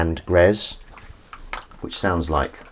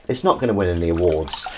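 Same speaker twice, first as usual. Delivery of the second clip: usual speaking voice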